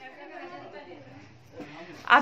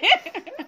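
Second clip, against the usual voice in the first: short high burst of laughter; 10 semitones higher than usual. Real, not acted. Laughing at boy. (छोटी ऊँची हँसी)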